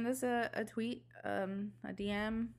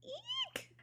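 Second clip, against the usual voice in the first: tiny high squeak of delight; fangirl squeal; 9 dB quieter than usual. Real, not acted. Fan squealing to her cohost about her favorite band.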